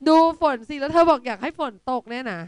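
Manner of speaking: can't believe what she just read; incredulous. Real, not acted.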